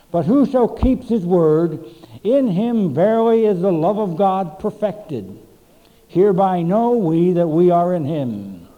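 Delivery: loud, ringing scripture reading from the pulpit; preaching at full force. Acted. Pastor reading First John aloud to the congregation, preaching at full force. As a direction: proclaim it to the whole church.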